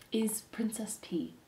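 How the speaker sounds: slow, hushed reveal of a name; reverent whisper. Acted. Lovestruck mouse confides the princess's name, reverent whisper. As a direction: whisper it slowly, as if precious.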